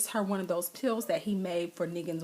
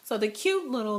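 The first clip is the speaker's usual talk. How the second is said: ordinary talk, nothing marked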